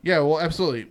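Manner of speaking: normal talking voice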